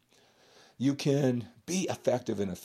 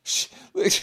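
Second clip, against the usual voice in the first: hushed mock whisper, much higher; 4 dB louder than usual. Gym guy nudging a friend, whispered aside. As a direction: hiss it to your gym buddy. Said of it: "and you go".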